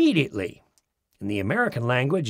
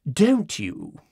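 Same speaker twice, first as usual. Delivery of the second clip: "Don't you?" split into two words, genteel English; elegant foreign way; 2 semitones lower than usual. Retold or imitated. Schoolteacher mimics aesthetes' elegant foreign "Don't you?". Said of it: "in the elegant foreign way".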